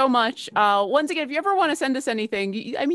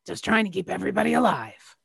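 ordinary talk

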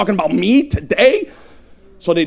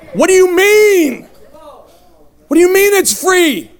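incredulous; 8 semitones higher than usual. Retold or imitated. Addict reacting to news that it's free, incredulous. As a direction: cry out in mock disbelief.

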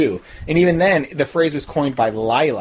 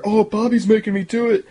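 high-pitched mocking quote; mock whining excuse; 8 semitones higher than usual. Reviewer mocks Dexter's excuse about Bobby, high mock whine. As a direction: imitate a character passing the blame, pitched up.

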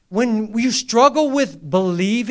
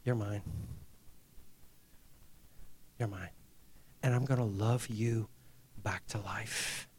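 tender voice of God; 11 semitones lower, 15 dB quieter than usual. Pretend. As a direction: claim him gently, almost whispering. Voicing God claiming him.

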